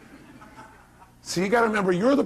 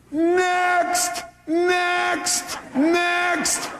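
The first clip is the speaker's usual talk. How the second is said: shouted; 14 semitones higher, much slower than usual. Shown as a demonstration. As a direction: yell it at the top of your lungs. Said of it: "yell two words at the top of your lungs".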